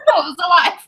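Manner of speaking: put-on high, laughing little-boy answer; boy reassuring his aunt, laughing; amused boy's reply. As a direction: answer brightly like a child, laughing. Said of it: "And she's like".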